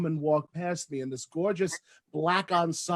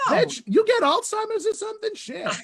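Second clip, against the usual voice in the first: sassy mock outrage; 13 semitones higher than usual. Mock quarrel on street corner.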